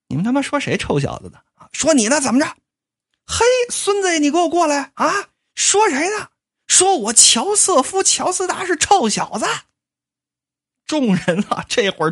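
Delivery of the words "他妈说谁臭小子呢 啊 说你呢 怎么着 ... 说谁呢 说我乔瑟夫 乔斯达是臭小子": much higher, heated yelling at the guards; angry challenge. Acted. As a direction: pick a fight with them. Joseph squaring up to the guards.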